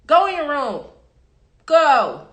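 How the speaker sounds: loud, high command to the dog; shouted order. Real, not acted. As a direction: yell at the dog to go to its room.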